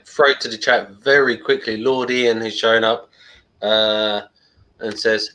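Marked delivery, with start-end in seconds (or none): none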